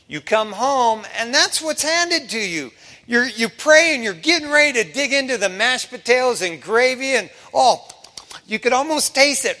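preacher builds the dinner scene loud and high; building excitement. Acted. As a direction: work the crowd up to the punchline.

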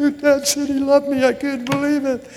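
high, blubbering tearful voice; mock crying. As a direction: act out sobbing through the words.

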